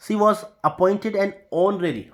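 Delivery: slow, careful example sentence; carefully spaced teaching read. Shown as a demonstration. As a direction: read the example slowly for students. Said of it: "honorary नहीं".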